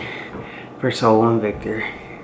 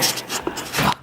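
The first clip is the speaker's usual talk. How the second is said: whispered curse; 5 dB quieter than usual. Real, not acted. Brother arriving, hiding.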